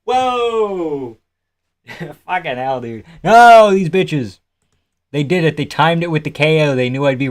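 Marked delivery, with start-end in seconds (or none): 0.0-1.2 mock whoa. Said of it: "He's like". 3.2-4.4 mock outrage.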